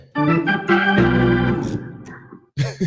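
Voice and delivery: breathless, drawn-out laugh; long laugh. Real, not acted. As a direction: crack up at the caller's story. Host cracks up at story.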